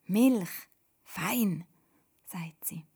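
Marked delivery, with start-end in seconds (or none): 0.1-1.7 halting single words, punched out; practising speech. A narrator's reading. Learner practising speech at breakfast, halting, word by word. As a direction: say each word separately, like a learner. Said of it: "Toda übt Reden".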